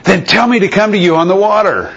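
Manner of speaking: a bold disciple calling across the water; eager Peter. Acted. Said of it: "Peter replied".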